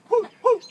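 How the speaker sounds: high hooting whoo whoo; imitating a friend's high-pitched hoot. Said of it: "started making this high-pitched noise".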